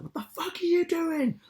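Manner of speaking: high angry shout at the family; furious yell. Retold or imitated. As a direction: bark it out like a cyclist braking hard. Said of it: "I was like".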